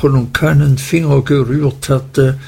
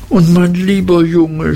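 raised, loud tender cry; 6 semitones higher, 3 dB louder than usual. A narrator's reading. Mother cries out to her little son, loud and raised. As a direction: cry out fondly to the boy. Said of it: "schrie meine Mutter".

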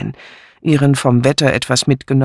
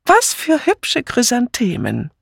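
high, slow relieved gush; 11 semitones higher than usual. A narrator's reading. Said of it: "rief sie".

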